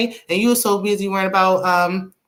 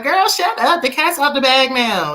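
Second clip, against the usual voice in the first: higher-pitched, exasperated telling-off; exasperated retort; 6 semitones higher than usual. Retold or imitated. Recapper telling a stalling wife the secret is out, exasperated. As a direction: cut her off, pitched up in disbelief.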